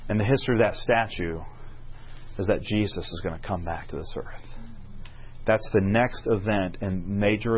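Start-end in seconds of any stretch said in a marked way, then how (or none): 2.4-4.4 hushed, reverent sermon climax; hushed and solemn. Real, not acted. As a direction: drop to a low, soft voice.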